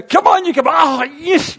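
high-pitched, excited sports-fan yell; jumping-up-and-down cheering. Retold or imitated. Pastor imitating himself cheering Great Britain's team, wildly excited. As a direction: urge the athlete on, re-enacted. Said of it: "jumping up and down going".

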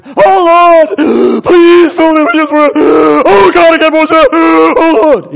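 hammed-up, high-pitched pleading shout; wailing, over-the-top begging at full volume; mock straining prayer. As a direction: bellow a desperate prayer, overdoing the striving. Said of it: "they weren't going".

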